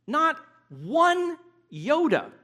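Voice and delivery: Not one iota, slow and drawn out, with "one" lifted high and leaned on; preacher dwells on "one" to show no part falls; stress on one. Acted. Preacher to congregation, slow stress on "one".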